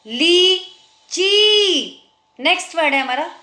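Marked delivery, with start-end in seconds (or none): 0.1-2.0 "लीची" with long ली, pause, long ची; slow dictation of "लीची"; both vowels long. Shown as a demonstration. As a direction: stretch both ee sounds in "लीची", higher. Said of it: "च में भी बड़ी आवाज़".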